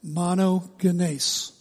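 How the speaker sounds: "Monogenes" very slow, MAH-no-geh-NACE; Greek pronunciation. Shown as a demonstration. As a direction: model "Monogenes" for repeating, hard g. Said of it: "Say it again".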